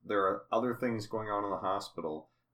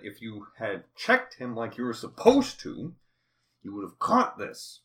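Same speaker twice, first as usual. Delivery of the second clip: scolding; 2 semitones higher, 5 dB louder than usual. Retold or imitated. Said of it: "And Boyer said".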